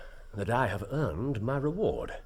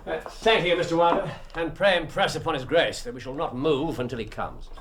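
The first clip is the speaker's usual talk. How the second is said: higher, louder, insistent; forceful firmness; 8 semitones higher, 6 dB louder than usual. Acted. Holmes telling the secretary they won't leave. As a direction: press hard, you won't budge.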